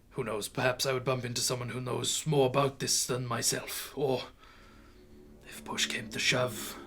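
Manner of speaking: quiet, halting confession; lightly voiced frail old man, long pauses; ashamed old man. Old man promising it is an isolated incident, quiet and ashamed.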